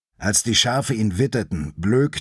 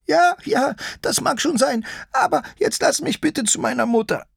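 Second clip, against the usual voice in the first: very high little sheep voice; high, childlike whiny creature; pleading sheep-lion. Acted. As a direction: beg in a tiny high-pitched voice.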